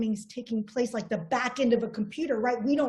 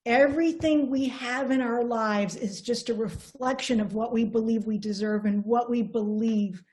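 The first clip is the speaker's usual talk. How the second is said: ordinary delivery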